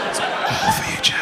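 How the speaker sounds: slow, breath-only secret aside; hushed mock-intimate promise; confiding whisper. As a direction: whisper it slowly to one person. Secret aside to audience member.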